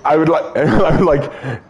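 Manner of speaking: ordinary talk, nothing marked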